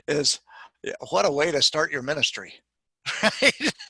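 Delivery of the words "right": breaking into a real laugh; high, amused tag question dissolving into laughter. Real, not acted. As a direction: laugh heartily at your own remark. Laughs at own joke.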